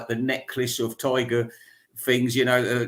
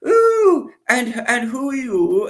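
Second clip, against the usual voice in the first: very high, loud plummy lady's voice; hammed-up aristocrat swooping up in mock surprise; mock posh lady-in-waiting, high and grand; posh old lady; 10 semitones higher, 6 dB louder, slower than usual. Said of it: "you know, she said".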